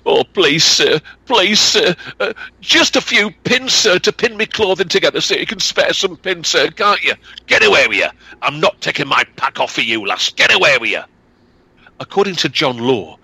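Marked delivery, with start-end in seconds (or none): 0.0-7.1 put-on high, begging girl's voice; high-pitched wheedling plea; pleading young girl. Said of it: "Alison begged of him". 7.5-11.0 a hint of a gruff peddler brushing her off; lightly voiced dismissive trader. Peddler refuses the girl.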